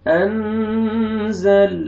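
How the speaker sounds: انزل with the zal held long, lam not moved; slow drawn-out recitation. Shown as a demonstration. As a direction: stretch out انزل slowly. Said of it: "لام کو ہلانا نہیں ہے".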